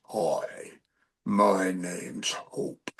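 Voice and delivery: very low, slow, soft put-on voice; bodybuilder woman. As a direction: imitate a muscle-bound woman, deep and drawn out. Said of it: "like a bodybuilder chick".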